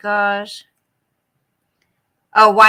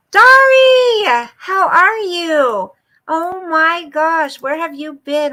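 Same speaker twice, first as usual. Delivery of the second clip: much higher, louder, slow drawn-out welcome; drawn-out excited shout of welcome; excited greeting; 6 semitones higher, 8 dB louder, slower than usual. Real, not acted. Greeting long-missed viewer.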